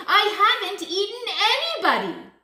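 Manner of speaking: fast, high, loud wail of innocence; howling protest. A narrator's reading. Big Bad Wolf locked out by Missus Duck, howled protest. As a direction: howl the denial. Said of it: "he howled".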